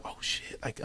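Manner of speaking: hushed, voiceless murmur among onlookers; imitated crowd whisper. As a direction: whisper it to the person beside you. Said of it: "they're whispering among themselves".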